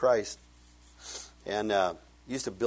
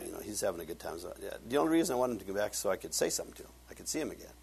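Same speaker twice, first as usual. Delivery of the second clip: normal talking voice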